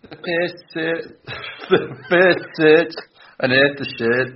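slow, high try at a Scouse accent; Scouse accent attempt. Shown as a demonstration. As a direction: stretch out the Scouse accent carefully, slowly. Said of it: "have a stab at these three sentences".

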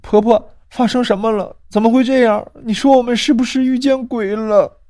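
put-on high, startled girl; frightened. Girl asking grandma if they met a ghost, frightened and high. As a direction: gasp out in alarm. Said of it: "女孩也吓了一跳".

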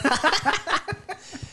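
sudden high-pitched laugh. Real, not acted. Laughs at opponent's loss.

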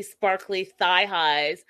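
normal talking voice

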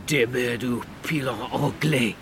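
French curse spat out in fury; exploding in anger. Acted. Bernard exploding at the narrator, furious. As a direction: explode at the Englishman. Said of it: "he exploded".

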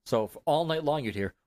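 normal talking voice

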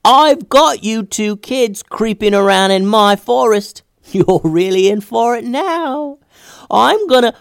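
high, slow, gleeful sprite taunt. Acted. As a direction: drag out the taunt like a prankster sprite. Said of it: "before the sprite could finish".

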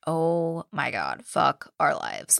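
low, slow resigned groaning words; mock despair. Retelling the group stuck amid scary noises, dejected. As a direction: drag it out low, imitating hopeless dread.

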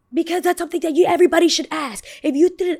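high, insistent irate customer imitation; mock indignant argument. Customer insisting everybody should ask, high mock indignation. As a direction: argue heatedly as the customer. Customer arguing back, acted out.

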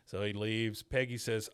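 usual speaking voice

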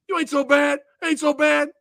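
high, loud cocky ring taunt; imitating a boxer's taunt; mock taunt. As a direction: sneer it at a beaten fighter. Mocking Clubber taunt in the ring.

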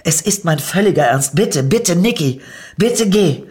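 frantic begging as she pushes her lover out the door; desperate pleading. Acted. Pleading Nicky to go.

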